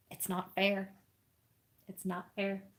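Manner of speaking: hushed, playful grumble; soft mock complaint. Real, not acted. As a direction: complain softly that the puppy is too cute.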